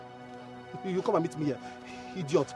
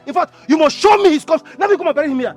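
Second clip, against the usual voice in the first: loud, higher-pitched outburst; angry demand; 3 semitones higher, 8 dB louder than usual. Acted. Brother confronting Patrick over a burial, angry demand.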